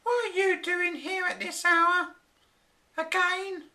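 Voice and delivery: high-voiced nagging wife; angry wife. A narrator's reading. Wife scolding the professor for working late, high and cross. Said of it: "his angry wife, Flora".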